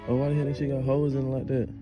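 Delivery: ordinary talk, nothing marked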